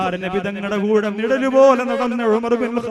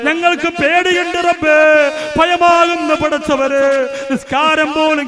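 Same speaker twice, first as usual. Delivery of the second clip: impassioned preaching; 5 semitones higher than usual. Acted. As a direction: lift the voice into a fervent plea. Fearful plea to God.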